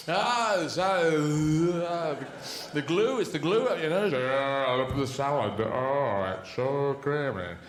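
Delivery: slow, spaced-out mock rapture; dazed glue sniffer. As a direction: drift through it, hazily enthralled. Diner high on salad solvent.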